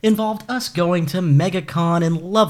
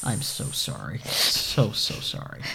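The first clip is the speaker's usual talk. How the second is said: low, soft whispered sorry; whispered apology; 10 semitones lower, 7 dB quieter, slower than usual. Real, not acted.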